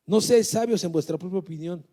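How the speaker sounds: booming, loud scripture proclamation; preaching at full force. Acted. Preacher reading Romans to the congregation, loudly proclaimed. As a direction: thunder the verse out to the church.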